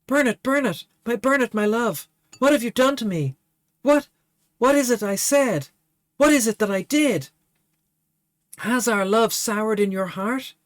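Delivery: high, anguished lady's voice; grieving maiden. Acted. Dying Favia crying out to her beloved, high and anguished. Transformed Favia cries to Burnett.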